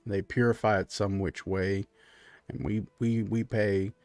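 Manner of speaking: low, soft, halting country man; easygoing furbolg host. Acted. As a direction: amble through it, low and quiet. Game master plays Clyde.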